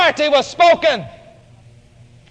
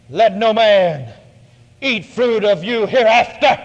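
low, slow, commanding; voice of authority; 6 semitones lower, slower than usual. Acted. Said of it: "with authority in his voice".

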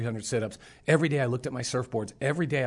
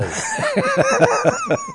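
loud high-pitched burst of laughter; 11 dB louder than usual. Real, not acted. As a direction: let out a big squealing laugh. Laughter after mojo line.

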